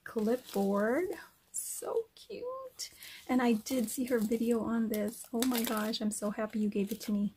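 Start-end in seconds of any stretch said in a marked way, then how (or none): 1.5-2.9 whispered gush. Real, not acted. Gushing over a gift.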